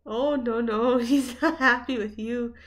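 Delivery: loud laughing warning. Real, not acted. Laughing at grumpy dog.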